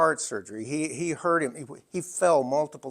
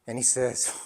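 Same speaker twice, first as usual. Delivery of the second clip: usual speaking voice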